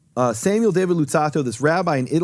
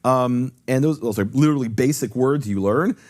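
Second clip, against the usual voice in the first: usual speaking voice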